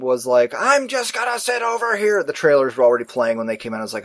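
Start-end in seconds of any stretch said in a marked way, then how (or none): 0.6-2.2 lightly voiced old woman. Old woman grumbles about her seat.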